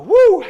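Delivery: loud high whoop; excited whoop. Real, not acted. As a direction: cheer after the set. Finishing push-ups, cheering.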